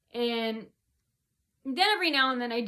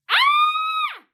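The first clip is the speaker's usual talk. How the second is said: imitated scream, much higher and louder; mock scream; 12 dB louder than usual. Coworkers screaming nonstop, imitated as a high loud scream. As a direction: let out the coworkers' piercing scream.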